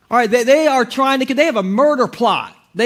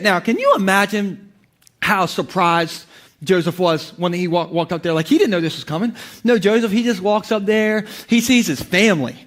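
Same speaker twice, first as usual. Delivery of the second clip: normal talking voice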